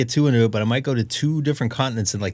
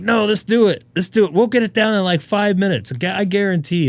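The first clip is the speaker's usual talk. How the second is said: higher-pitched imitation of an insistent friend; eager urging; 7 semitones higher than usual. Friend pushing him to do it in the heat, eager imitation. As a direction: mimic a friend eagerly talking you into it.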